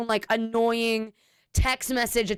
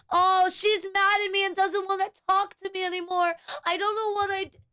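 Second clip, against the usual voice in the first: high, whiny parody of mom; hammed-up teenage drama voice; mocking whiny mom; 8 semitones higher than usual. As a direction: mimic her petty high-school whine. Mocking mother's petty drama.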